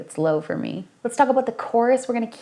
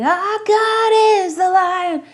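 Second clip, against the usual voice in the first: "Our" reached for and strained, higher; 9 semitones higher, 4 dB louder, slower than usual. Shown as a demonstration. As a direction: strain up to the note. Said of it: "if you are reaching and straining".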